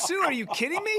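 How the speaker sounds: high-pitched retold disbelief; mock-indignant, voice shooting up; incredulous. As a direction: re-enact being stunned by a snub. Retold disbelief at not being recognized.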